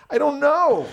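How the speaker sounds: high-pitched, helpless mock protest; baffled. Comedian answers bandmate about the fight he started, baffled. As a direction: squeak out a clueless answer. Baffled reply to what is going on.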